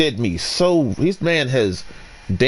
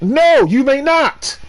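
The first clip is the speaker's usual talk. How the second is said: high, slow and pointed; "no, you may not" drawn out high; defiant refusal; 9 semitones higher, slower than usual. Retold or imitated. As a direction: refuse it with haughty slowness. Defiant retort to clerk.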